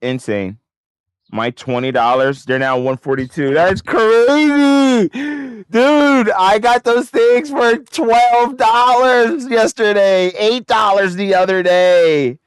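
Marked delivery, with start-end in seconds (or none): 3.5-12.4 high, loud and slow disbelief; real excitement. Real, not acted. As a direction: shout it out, stunned by the gains. Contracts jumping in value live.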